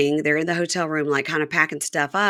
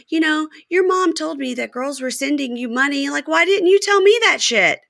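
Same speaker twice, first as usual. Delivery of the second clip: higher mock accusing girlfriend; voicing Joy; 7 semitones higher than usual. Podcaster voicing Joy confronting Red, higher. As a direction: needle him like a suspicious girlfriend.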